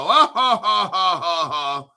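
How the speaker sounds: a loud burst of laughter that fades off; real mocking ha ha ha dying away; scornful laugh. Real, not acted. Laughing at mocked commenters.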